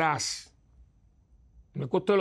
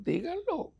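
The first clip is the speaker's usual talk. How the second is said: very high, squeaky mock plea; mocking falsetto plea; mock squeal; 14 semitones higher, 4 dB quieter, slower than usual. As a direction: squeak it like a fussy churchgoer. Mocking protesting parishioner.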